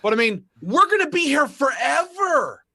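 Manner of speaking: loud, high rallying shout. Real, not acted. Rallying gun owners against politicians, loud and high. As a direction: proclaim it like a rally cry. Rallying speech at full force.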